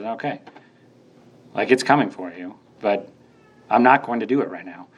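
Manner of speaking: low, easy, patient reply; calm Jesus. Retold or imitated. Jesus answering the begging demons, low patient calm. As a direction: gently grant a delay. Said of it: "And Jesus says".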